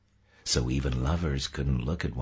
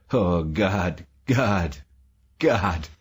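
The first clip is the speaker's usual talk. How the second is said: anguished cries, higher and louder; breaking into sobs; 5 semitones higher, 5 dB louder, much slower than usual. A narrator's reading. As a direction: cry out as sobs take over. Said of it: "He burst into sobs".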